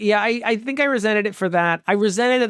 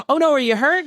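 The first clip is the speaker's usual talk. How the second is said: alarmed; 9 semitones higher than usual. Retold or imitated. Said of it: "I'm like".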